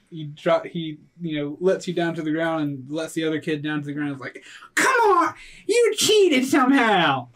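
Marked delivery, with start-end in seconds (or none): 4.7-7.3 high accusing voice. Acted. GM voices Trent accusing cheating.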